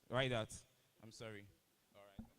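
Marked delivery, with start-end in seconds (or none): 1.0-2.3 quiet aside. Real, not acted. Apologizing aside after a mistake.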